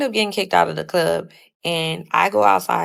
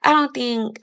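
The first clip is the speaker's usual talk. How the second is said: higher-pitched voice for mom's protest; imitated mom; 7 semitones higher than usual. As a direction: mimic mom pleading against it, pitched up. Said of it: "and she's like".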